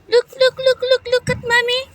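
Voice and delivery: high-pitched coaxing to a pet. Real, not acted. High call to dog.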